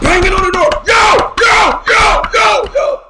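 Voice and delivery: high, very loud hollering; full-voiced calling out to get attention; rowdy shouting. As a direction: holler like a mob pounding on the door. Mob at the door, acted.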